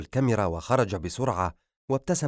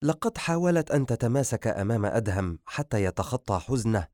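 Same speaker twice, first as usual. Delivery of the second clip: plain reading voice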